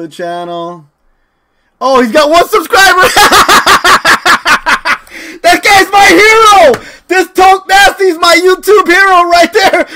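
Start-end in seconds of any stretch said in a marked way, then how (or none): 1.8-9.9 high, loud laughing outburst; cracking up. Real, not acted. Streamer discovering one subscriber, cracking up. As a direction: shout through real laughter.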